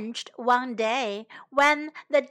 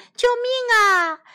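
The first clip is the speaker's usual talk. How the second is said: shouting for help; 9 semitones higher, 7 dB louder than usual. A narrator's reading. Frogs call for rescue from developers, shouting. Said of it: "青蛙们喊着".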